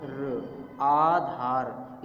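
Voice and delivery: आधार said very slowly, both आ held long; stretched long vowel. Shown as a demonstration. Teacher spelling आधार out slowly for the class. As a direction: sound out आधार, lingering on each आ. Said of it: "यानी देर तक उसको बोलेंगे".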